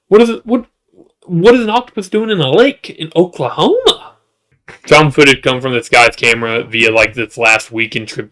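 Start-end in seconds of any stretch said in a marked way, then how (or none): 0.1-4.2 high-pitched, incredulous questioning; comic bafflement. Pretend. Host wonders about an octopus in Oklahoma, comic bafflement.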